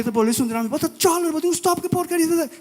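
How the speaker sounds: very high, put-on voice of the brother; mocking brother. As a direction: squeak his brother's words to mock them. Voices his brother in the story. (imitando o irmão, voz bem aguda)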